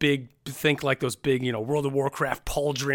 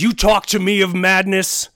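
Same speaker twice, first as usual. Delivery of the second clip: much higher, louder furious tirade; ranting orc warlord; 9 semitones higher, 9 dB louder than usual. Acted. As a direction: hurl every grievance. Villain rant, loud.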